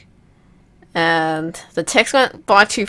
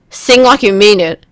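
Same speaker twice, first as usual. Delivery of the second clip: ordinary talk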